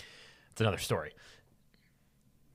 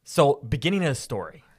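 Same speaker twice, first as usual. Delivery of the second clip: lingering storyteller opening; narrator voice; 6 dB louder than usual. Acted. Starting the retelling, in a narrator voice. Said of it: "turn off your narrator voice now".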